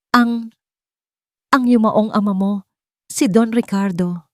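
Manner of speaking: put-on old woman forcing out a frightening truth; mildly quavering confession; trembling. A narrator's reading. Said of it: "Nanginginig na panimula ng matanda".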